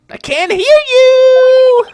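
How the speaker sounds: very high, loud, long-drawn holler; goading yell. Acted. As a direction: egg them on, stretching out the last word. Demands a louder repeat of chant.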